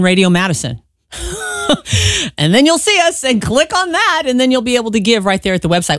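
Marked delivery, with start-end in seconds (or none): 1.1-2.3 bright high burst of laughter. Real, not acted. Host laughing at her own radio plug, high laugh. 2.4-6.0 laughing. Real, not acted. Laughing through the plug.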